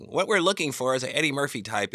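a hint of putting out a casting call; casting caller. Voices casting line from the film.

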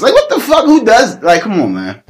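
real frustrated squeal; exasperated outburst. Real, not acted. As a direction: throw up your hands in disbelief. Exasperated at boring dreams.

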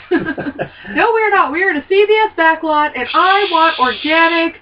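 high, loud indignant activist; fussy, insistent outburst; Ed Begley Junior. Acted. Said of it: "Mister Begley".

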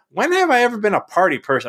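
high, loud incredulous protest; indignant retort. Retold or imitated. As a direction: push the question up high, baffled. Retorts to being called partier.

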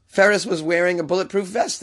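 normal talking voice

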